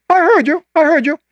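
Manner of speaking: high, bright insistence; a high, eager I heard you; emphatic reassurance. Retold or imitated. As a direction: cut him off, he heard right. Generous man reassures surprised friend.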